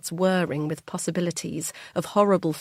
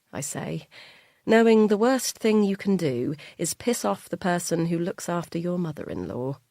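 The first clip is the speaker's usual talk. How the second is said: plain reading voice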